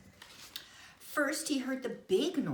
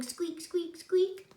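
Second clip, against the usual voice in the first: high, louder squeaky question; high squeak; 11 semitones higher, 4 dB louder than usual. Retold or imitated. Noise imitated in story.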